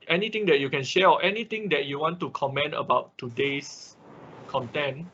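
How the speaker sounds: ordinary talk, nothing marked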